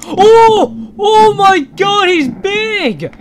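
high, loud, drawn-out cry of astonishment; real startled yell; shocked exclamation. Real, not acted. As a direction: shout in shock at something huge. Huge game character suddenly appears.

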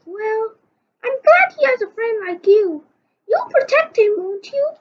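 high put-on nurse voice. Nurse reassures Chief about Dogman, high and light.

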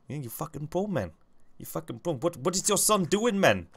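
high-pitched South African movie impression; South African accent. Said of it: "in the South African accent is quotes from".

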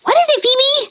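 high, fast cartoon puppet chatter; squeaky puppet voice. Fizzy the puppet cheering Phoebe, high cartoon voice. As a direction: chirp it like a tiny cartoon friend.

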